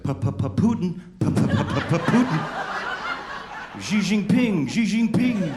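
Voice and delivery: stuttering high, loud engine sputter; car engine noise. Pretend. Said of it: "my car make noises like".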